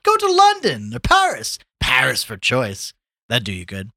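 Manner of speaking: high, loud, expansive advice; worldly Gallagher, urging. Acted. Gallagher urges Tommy off to London or Paris, expansive. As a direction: boast like a well-travelled pal.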